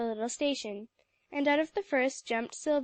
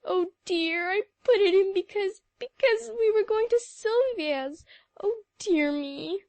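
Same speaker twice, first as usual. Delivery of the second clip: tearful sobbing; 8 semitones higher, 5 dB louder than usual. A narrator's reading. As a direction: break down crying over the lost pin. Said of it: "sobbed outright".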